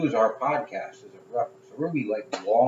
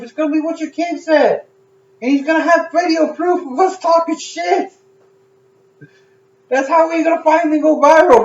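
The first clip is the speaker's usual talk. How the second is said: excited shouting; 13 dB louder than usual. Real, not acted. Ranting about going viral.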